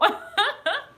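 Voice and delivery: high rising laugh; a high laugh that rises at the end. Real, not acted. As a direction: let out a high giggle. Laughs after quoting Maria.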